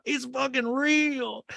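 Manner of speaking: high, drawn-out mock wail; Sutton. Recapper mimicking a castmate crying in a fight, mock wail. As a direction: mimic a tearful cry, stretched out. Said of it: "And Sutton's crying".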